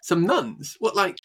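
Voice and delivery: high incredulous question; disbelieving. Real, not acted. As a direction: ask it in real disbelief. Disbelief at nun interviewers.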